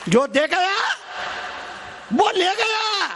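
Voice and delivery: high, climbing preacher's cry; emphatic proclamation. Real, not acted. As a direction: ring it out over the crowd. Same line proclaimed to crowd. (ऊँचे स्वर में घोषणा)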